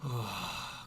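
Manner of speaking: long, weary exhale; heavy sigh. Real, not acted. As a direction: sigh out the frustration. Fed up with the trade.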